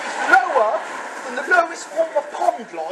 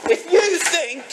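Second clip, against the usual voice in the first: real rage at the man he blames, yelling him down; furious shouting; 3 semitones higher than usual. Real, not acted. Confronting his dad over asbestos, furious shouting. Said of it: "I went in mental".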